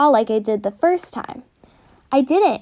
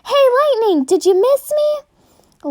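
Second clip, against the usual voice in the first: loud, much higher playful shout; playful yell; 10 semitones higher, 4 dB louder, slower than usual. Acted. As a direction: yell it right in his ear.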